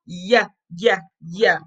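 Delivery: "Yeah" as a short, low yuh; Y sound. Shown as a demonstration. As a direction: separate yuh beats for each "Yeah". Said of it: "what's the Y sound".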